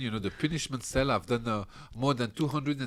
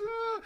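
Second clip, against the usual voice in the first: high imitated wail of a crying man; mock crying wail. Cellmate waking him at dawn, high mock wail.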